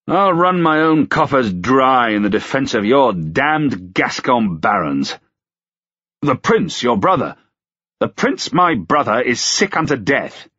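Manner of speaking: heated complaint; angry outburst. A narrator's reading. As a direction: vent at the constable. Acted angry outburst after shout.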